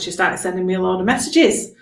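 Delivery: ordinary delivery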